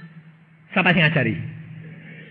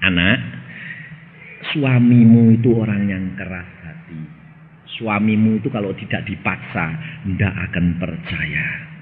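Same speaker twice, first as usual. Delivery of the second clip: gentle voice of God; 6 semitones lower than usual. Retold or imitated. God gently reassuring the jealous wife, tender. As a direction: comfort her like a loving father. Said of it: "Tuhan berkata begini".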